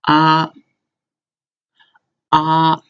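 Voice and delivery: high level ah held steady; first tone ah. Shown as a demonstration. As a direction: hold ah high and flat. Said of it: "the first one is".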